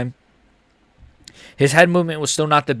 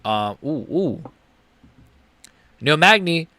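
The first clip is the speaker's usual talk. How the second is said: usual speaking voice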